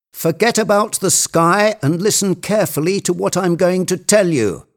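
high, urgent treasure hunter; commanding Legrand. Acted. Legrand directing his climber up the tree, high and insistent. Figure of the story giving orders.

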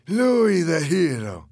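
high, loud, slow mock title; drawn-out jeer; taunting. A narrator's reading. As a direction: chant the title at him mockingly. Fernando taunts him repeatedly.

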